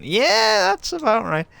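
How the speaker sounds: high mocking squeak agreeing with the game; high squeaky yeah. Real, not acted. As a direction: jump way up into a squeak on Yeah, then slide down. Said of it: "agreeing with Horizon's outburst".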